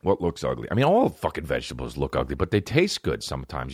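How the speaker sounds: ordinary delivery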